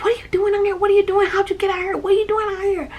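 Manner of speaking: very high, rapid-fire retold questions; alarmed rescuer. Sister's friend right in the rescued child's face, high frantic questioning.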